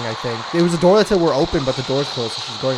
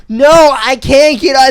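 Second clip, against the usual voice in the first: loud, high, drawn-out retort; slow, high mock yell; mock protest; 6 semitones higher, 9 dB louder, slower than usual. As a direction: act out an exasperated refusal. Acting out the quarrel.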